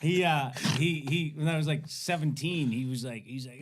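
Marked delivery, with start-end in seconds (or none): none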